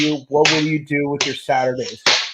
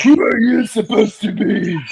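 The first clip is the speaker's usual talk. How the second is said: Goldar voice; 5 semitones higher than usual. Retold or imitated. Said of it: "I can do Goldar".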